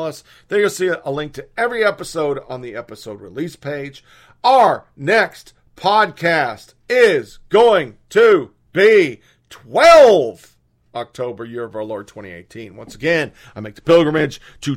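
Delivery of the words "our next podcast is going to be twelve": drawn out, loud and slow; slow emphatic. Real, not acted. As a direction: stretch every word out.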